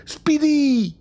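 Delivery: high joyful call of a pet's name. Acted. Professor greeting his pet tortoise, a high happy call. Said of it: "crie le professeur".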